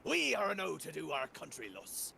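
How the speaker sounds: lightly voiced pirate reciting Shakespeare; hint of pirate, declaiming Shakespeare. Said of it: "quote from Shakespeare, but talk like a pirate".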